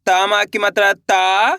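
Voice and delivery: slowly drawn-out teaching read of ता; ता said slowly with a clear long आ; slow syllable drill. Shown as a demonstration. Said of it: "कैसे पढ़ेंगे?". (धीरे से खींचकर ता बोलना)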